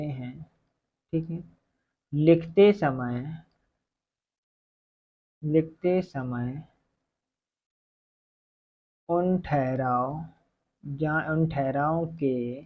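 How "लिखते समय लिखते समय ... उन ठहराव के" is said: very slow, stretched-out phrases with long gaps; slow dictation. Real, not acted. Teacher dictates the definition as it is written, slow dictation. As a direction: dictate slowly so it can be written down.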